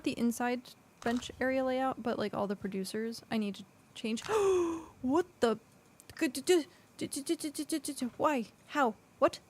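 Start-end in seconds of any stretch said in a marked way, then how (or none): 4.3-5.0 startled gasp. Real, not acted. Sudden in-game attack. 5.0-9.4 high, stammering shock; startled disbelief. Real, not acted. Attacked by creature in game.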